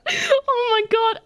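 high, slow, laughing squeal; real helpless laughter. Real, not acted. Player watching the goose's sassy walk, laughing helplessly.

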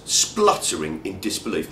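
ordinary talk

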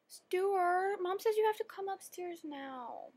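put-on high, soft child voice calling out; little sister calling. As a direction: relay mom's order in a little-girl singsong. Little sister calls brother again.

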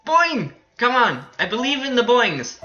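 high, loud urging; rallying cry. Real, not acted. Gamer willing the bounce to work, rallying cry. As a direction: call it out high like a pep cheer.

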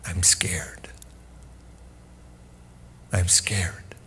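soft, low whispered confession; whispered fear. Acted. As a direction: confess it barely aloud. Voicing Jesus' fear.

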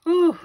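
sharp high-pitched exhale, real relief; loud high whew. Real, not acted. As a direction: blow out a big whew. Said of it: "let me catch my breath".

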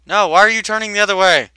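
loud, fast exasperated shout. Real, not acted. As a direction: yell at the crew in frustration. Crew turning wrong way.

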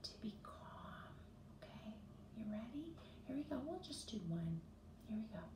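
soft unvoiced whisper to settle kids; calm whisper. Teacher whispering to children to calm down. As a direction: hush them gently before the story. Calming breath lead-in.

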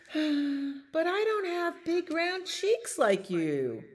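high-voiced penguin after a sigh; sigh, then turn the chick down in a high voice. A narrator's reading. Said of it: "sighed Missus Penguin".

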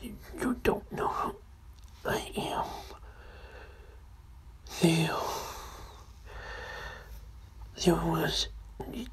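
hushed, eerie whispering; creepy whisper. Acted. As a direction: whisper like a hooded stranger.

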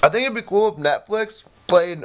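eating while talking; mouth full. Real, not acted. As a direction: talk through a mouthful. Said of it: "mouth full".

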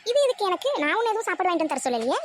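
high, startled question; surprised. Acted. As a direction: caught off guard by the money. Mother surprised at money offer. (திடுக்கிட்ட உயர்ந்த குரல்)

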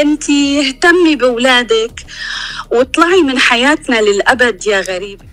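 shouting through tears, very loud; furious sobbing outburst; angry crying. Real, not acted. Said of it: "فقدت [name] أعصابها وبدأت بالبكاء".

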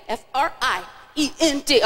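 "F R I E N D" measured out, gap after I; spaced-out spelling. Shown as a demonstration. Host rehearses kids before their yell, "F R I", gap, "E N D". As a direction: spell F R I, pause, then E N D. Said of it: "So let's practice it".

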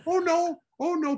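the father rushing up in alarm; dad panicking at his son's pledge, high and loud. Retold or imitated.

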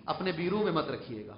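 ordinary talk

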